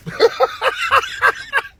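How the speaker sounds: squealing laugh; high burst of laughter. Real, not acted. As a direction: crack up high and long. Laughing at Hank impression.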